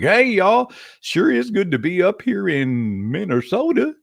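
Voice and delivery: put-on a loud, jokey Oklahoma accent greeting. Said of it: "that's just kinda how the Oklahoma accent goes".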